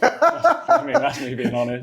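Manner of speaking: laughing through the words. Real, not acted. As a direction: giggle while owning up. Laughing at own candid admission.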